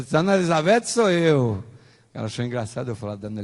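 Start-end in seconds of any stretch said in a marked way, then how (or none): 0.0-1.6 loud, drawn-out, delighted retort; mock hearty amusement, without actual laughter; amused exclamation. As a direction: imitate her amused announcement, higher and stretched out. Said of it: "ela deu uma risada gostosa".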